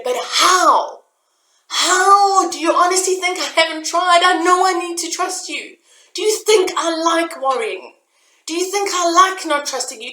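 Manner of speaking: high, loud, exasperated pleading shout; frustrated yelling. Retold or imitated. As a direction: yell your frustration up at the sky. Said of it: "I started screaming at my higher power".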